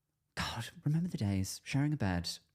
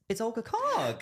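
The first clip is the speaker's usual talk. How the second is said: excited guest announcement; 12 semitones higher than usual. Real, not acted. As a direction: herald the guest with a big high call.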